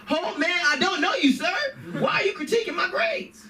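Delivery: very high-pitched indignant protest; hammed-up affronted squeal; mock indignation. Student objecting to questions about grades, high mock indignation.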